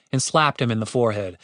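usual speaking voice

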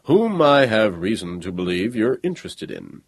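measured, hollow-voiced stranger; dry, hollow-voiced robot. A narrator's reading. Robot visitor presses Niels, dry and hollow. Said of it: "said in a dry, hollow voice".

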